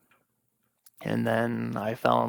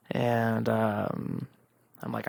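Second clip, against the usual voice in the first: ordinary talk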